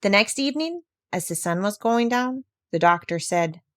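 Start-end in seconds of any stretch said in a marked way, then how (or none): none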